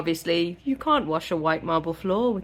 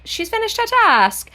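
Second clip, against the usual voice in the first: mock innocent; 6 dB louder than usual. Rhysand lies to guards.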